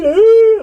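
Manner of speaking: loud, high imitation of a sob; mock ugly-cry wail. Showing how she was ugly crying, high mock wail. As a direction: wail it out like hammed-up bawling. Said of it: "ugly crying, too".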